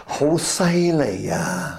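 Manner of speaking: slow, drawn-out Cantonese praise; Cantonese exclamation. Shown as a demonstration. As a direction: say it like an impressed Hong Konger. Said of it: "他们是什么样的反应".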